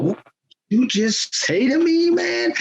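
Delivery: retold outrage. Shocked referee confronts player.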